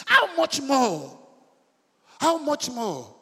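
loud, high, drawn-out exclamation; impassioned preaching cry. Real, not acted. Preacher comparing earthly fathers to God, impassioned cry.

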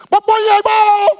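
very high, loud cry of go; exultant shout. Retold or imitated. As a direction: launch it like a rocket takeoff. Preacher imagines rising to glory.

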